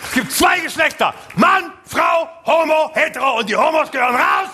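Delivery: ironic ranting shout. Pretend. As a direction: bellow it as mocking irony. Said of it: "jetzt nicht ironisieren". (ironisches Brüllen)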